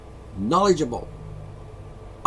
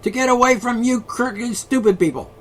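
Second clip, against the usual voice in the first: ordinary delivery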